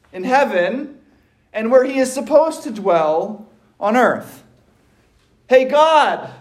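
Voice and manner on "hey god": a higher, brash call up to God; casual call to God. Retold or imitated. Isaiah hailing God bluntly, a higher brash call. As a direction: toss it up to God like hailing a buddy. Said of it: "Isaiah is saying".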